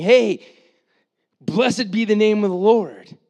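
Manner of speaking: loud and high, Hey called out; crowd shouting praise. Retold or imitated. Preacher voicing the fickle crowd's praise, loud. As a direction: hail him like a joyful crowd. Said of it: "people that's going".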